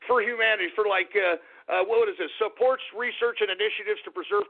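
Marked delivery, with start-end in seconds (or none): none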